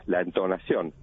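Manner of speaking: normal talking voice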